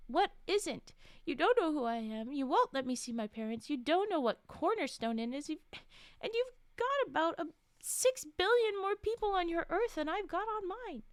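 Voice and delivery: loud, high, rising panic; upset, escalating protest; distraught outburst. Acted. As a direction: let her unravel into a frantic rant. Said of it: "Jennifer breaks down".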